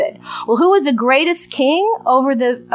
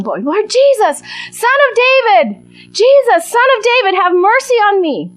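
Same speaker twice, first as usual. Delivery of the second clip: yelling plea; 8 semitones higher than usual. Retold or imitated. Blind beggar crying out to Jesus over crowd, yelling. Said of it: "Bartimaeus is yelling at Jesus".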